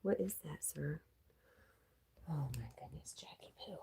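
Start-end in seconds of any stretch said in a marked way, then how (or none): 2.3-3.8 whisper. Real, not acted. Cooing at the dog.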